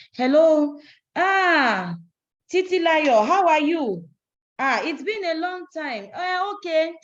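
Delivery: loud, high, overfamiliar hello; gushing phone greeting. Shown as a demonstration. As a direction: burst out as if greeting a long-lost friend on the phone. Acts out the wrong prospecting call.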